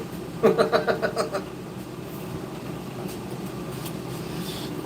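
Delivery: high, staccato laughing fit; real laugh. Real, not acted. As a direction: giggle high, then fade to breath. Cracks up at slang.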